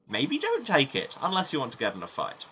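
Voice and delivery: usual speaking voice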